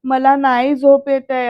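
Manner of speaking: ordinary talk, nothing marked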